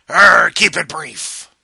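slow, loud growling pirate. Pretend. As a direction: bellow it like an old sea pirate. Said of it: "I'm gonna do a pirate voice".

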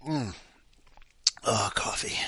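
breathy whispered aside. Real, not acted. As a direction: whisper the word coffee.

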